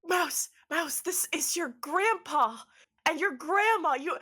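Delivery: high, eager father voice; acted breathless enthusiasm; excited introduction. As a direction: present the family in a high, thrilled voice.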